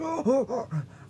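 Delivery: sharp high gasp acted out; startled mock gasp with a laugh; retold gasp. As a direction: act out a sudden gasp. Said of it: "And I'm just like".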